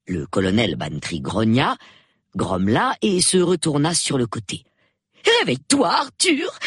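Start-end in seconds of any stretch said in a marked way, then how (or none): 5.4-6.6 high, insistent wife; urgent wake-up call. Acted. Wife wakes her sleeping husband, high and urgent. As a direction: call him awake in a high voice.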